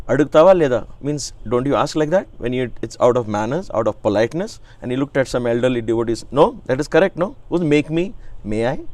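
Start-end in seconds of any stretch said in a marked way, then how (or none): 0.1-0.9 louder, higher Telugu challenge, lightly mimicked; mock-scolding Telugu question; imitating Swami. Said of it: "In Swami accent, you know, he becomes a child". 6.3-8.8 childlike guru, lightly mimicked Indian English, higher; playful imitation of an Indian guru's accent; imitating Swami's accent. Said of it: "In Swami accent, you know, he becomes a child".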